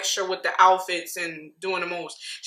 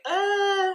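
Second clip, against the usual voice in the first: high, drawn-out puzzled uh; confused uh; 12 semitones higher than usual. Retold or imitated. Vlogger recalling being thrown by the monkey comment, confused uh. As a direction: hold a high uh, thrown for a loop. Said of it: "very confused. I was like".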